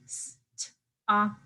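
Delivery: "st-, op" broken into isolated sounds with gaps; segmented phonemes. Shown as a demonstration. Teacher breaking a word into phonemes, sounds said apart. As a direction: sound out "st-, op" in separate pieces. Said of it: "break that down into phonemes".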